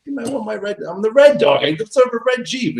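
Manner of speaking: high sulky whine; mock-petulant high whine. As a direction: whine like a sulking kid who wants the red one. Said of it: "I was in a bad mood".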